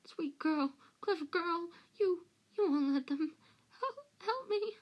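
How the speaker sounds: high, whimpering plea; pleading Pettigrew. A narrator's reading. Narrator voices Pettigrew begging Hermione.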